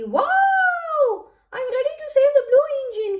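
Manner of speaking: high, fast, loud rallying cry; eager high-pitched shout; loud call. A narrator's reading. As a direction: call out excitedly, rushing to the rescue. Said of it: "he called with a honk".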